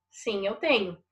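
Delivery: ordinary talk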